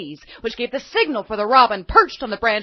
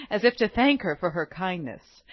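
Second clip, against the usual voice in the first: usual speaking voice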